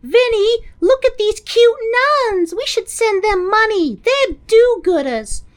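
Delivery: very high, gushing wife voice. Retold or imitated. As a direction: squeal over the cute nuns, high and sweet. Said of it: "Vince's wife sees the news and is like".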